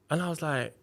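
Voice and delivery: ordinary delivery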